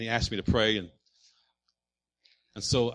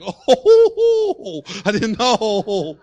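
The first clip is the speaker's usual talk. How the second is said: high laughing "oh", much louder and slowed; laughing surprise; 7 semitones higher, 7 dB louder, much slower than usual. Retold or imitated.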